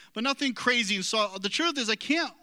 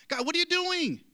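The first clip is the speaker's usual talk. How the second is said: put-on higher, exasperated outburst at the kids; imitating exasperated dad; 8 semitones higher than usual. Dad imitating his own outburst at his kids, mock exasperation. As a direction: snap at them like a fed-up father. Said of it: "just like that".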